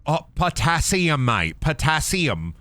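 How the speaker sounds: slow, put-on British accent; mock British. Gamer mocks British potassium, mock British accent. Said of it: "I make fun of the British".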